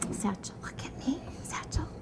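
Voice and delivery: soft whisper to get his attention. Real, not acted. Teacher whispers to distracted child. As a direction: hush it down to a whisper. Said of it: "getting child's attention".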